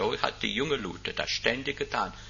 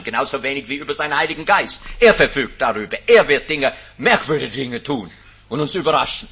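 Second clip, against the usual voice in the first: loud, high rising proclamation from the pulpit; preaching at full force; 4 semitones higher, 9 dB louder, faster than usual. Acted. Preacher to congregation, proclaiming the fourfold blessing at full force.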